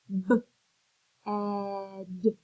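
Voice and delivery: B and ed split into separate sounds; sounding out. Shown as a demonstration. Teacher sounding out B, ed for young readers, slow and separated. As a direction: stretch B, ed apart slowly. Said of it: "I can finger stretch".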